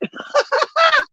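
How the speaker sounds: shrill burst of laughter; real laughter at herself; high laugh. Real, not acted. Laughs at becoming a grifter.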